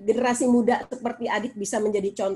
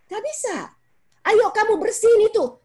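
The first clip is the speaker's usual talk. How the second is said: higher mock order to clean up; mock parent order; 8 semitones higher, 4 dB louder than usual. Parent ordering child to clean up, higher mock order. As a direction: play a parent bossing the child. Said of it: "cuman kamu begini".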